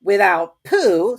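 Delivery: high, loud, slow solemn Rabbit; solemn fussy rabbit. A narrator's reading. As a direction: pronounce it solemnly. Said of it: "said Rabbit solemnly".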